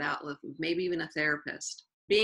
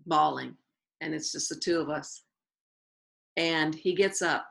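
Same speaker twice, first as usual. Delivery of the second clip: usual speaking voice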